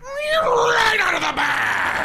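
drawn-out, high mock shout; mock exclamation. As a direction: stretch it out into a loud, high cry. Comedian acting Seinfeld exchange.

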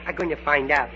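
ordinary delivery

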